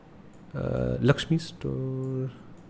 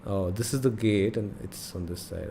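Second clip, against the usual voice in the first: ordinary talk, nothing marked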